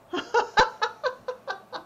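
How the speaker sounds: a bright, high burst of laughter; laughing. Acted. Wife laughing at her husband's leprechaun joke, a high laugh.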